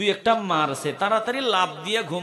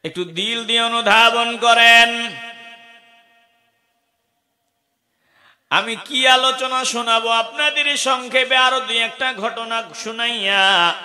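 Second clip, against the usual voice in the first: higher, louder sermon voice; fervent preaching; 8 semitones higher, 9 dB louder than usual. Acted. Preacher urging the gathering to take it to heart, raised. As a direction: urge them with full force.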